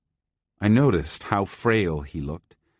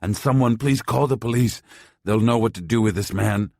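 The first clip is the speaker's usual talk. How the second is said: loud urgent plea; insistent; 4 semitones higher, 4 dB louder than usual. A narrator's reading. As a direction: insist the police be called. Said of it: "he insisted".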